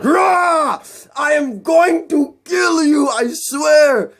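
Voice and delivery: high, slow roared threat in mock Russian accent; hammed-up furious villain vowing revenge; enraged villain.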